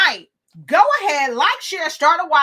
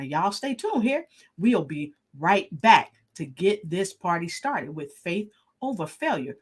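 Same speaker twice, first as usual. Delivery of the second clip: ordinary talk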